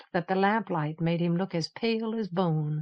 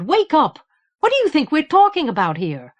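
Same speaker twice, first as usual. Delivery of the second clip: high, loud, angry outburst; angry retort; 8 semitones higher, 8 dB louder than usual. Acted. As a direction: snap at him, suddenly furious. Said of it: "Unexpectedly angry, she retorted".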